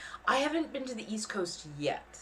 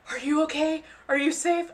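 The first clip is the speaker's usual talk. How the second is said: worried followers; 9 semitones higher, 4 dB louder than usual. Retold or imitated. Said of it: "reach out".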